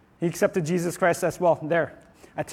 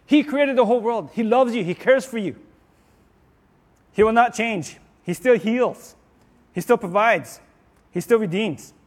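rising, louder preacher's build; preaching; 4 semitones higher, 4 dB louder than usual. Acted. Preacher rallies the congregation with louder, higher declarations. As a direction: preach it, lifting the room.

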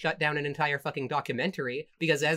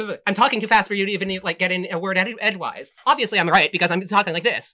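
fast, louder pundit parody; mock fast talker; 3 semitones higher, 6 dB louder, faster than usual. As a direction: rattle it off nonstop, smugly. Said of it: "you do way better impressions".

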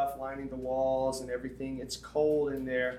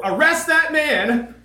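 indignant demand; 12 semitones higher, 11 dB louder, slower than usual. Retold or imitated. Imagined call to boss.

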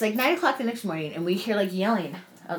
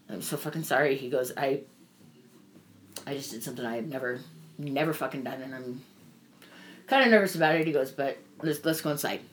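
tender apology; 6 semitones lower, 4 dB quieter than usual. Retold or imitated. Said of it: "puts his arm around me".

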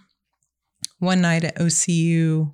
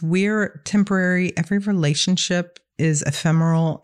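ordinary talk, nothing marked

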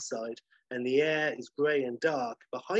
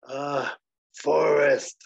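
drowsy sloth; 6 dB louder, much slower than usual. Acted. Sloth repeats answer.

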